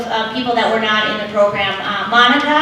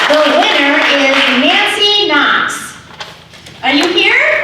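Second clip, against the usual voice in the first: loud announcement; 6 semitones higher, 5 dB louder, slower than usual. Real, not acted. Announcing raffle winner to the crowd.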